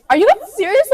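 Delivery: high, rising disbelief; incredulous. Acted. Daughter to family about the Texas plan, incredulous. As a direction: question them as if it can't be true.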